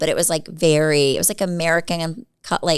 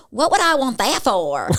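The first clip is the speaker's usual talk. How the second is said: exaggerated hillbilly twang, pitched up; thick country accent; 6 semitones higher, 3 dB louder than usual. Shown as a demonstration. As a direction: do your old thick country accent, higher. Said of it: "I would sound like this".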